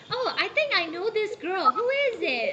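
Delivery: mildly surprised, wondering voice; surprised recognition. Retold or imitated. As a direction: act out spotting someone you might know. Acts out discovering the student online.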